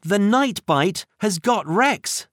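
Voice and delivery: exclaiming. A narrator's reading. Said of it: "exclaimed Minnie".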